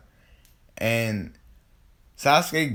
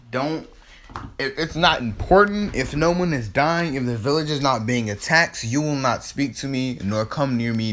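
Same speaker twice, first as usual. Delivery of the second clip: raised voice, higher and louder, cutting her off; angry telling-off; 5 semitones higher, 4 dB louder than usual. Retold or imitated. Naruto furiously orders the girl to keep away, loud and high.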